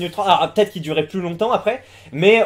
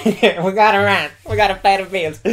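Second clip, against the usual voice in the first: mock American parroting, slowed down with a laugh; amused imitation. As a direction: parrot the interviewee through amusement. Mimics clip just played, laughing. (imitation moqueuse et amusée)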